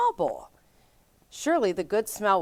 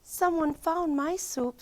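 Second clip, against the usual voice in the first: high, slow little bear voice; high little cub, slowly sputtering; sputtering Baby Bear; 10 semitones higher than usual. A narrator's reading. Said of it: "sputtered Baby Bear".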